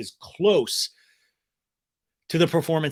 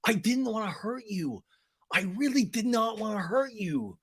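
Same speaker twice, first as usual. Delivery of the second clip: high, softer pleading imitation; mock whiny apology; 7 semitones higher, 4 dB quieter than usual. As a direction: plead it as a hammed-up sorry boyfriend. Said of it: "You should still fucking apologize".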